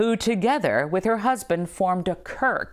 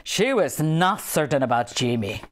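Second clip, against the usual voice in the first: "she was not certain about Jimmy" with a gentle Scottish accent, lower; light Scottish accent; 3 semitones lower than usual. Shown as a demonstration. Rereading the Jimmy line, light Scottish accent. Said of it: "a little accent".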